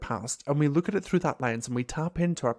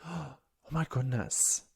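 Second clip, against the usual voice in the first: hushed awe; 2 semitones lower, 7 dB quieter, slower than usual. Retold or imitated. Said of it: "new graduate Liam would be like".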